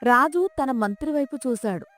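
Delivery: plain reading voice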